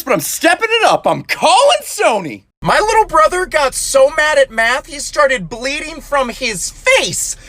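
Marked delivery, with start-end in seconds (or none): none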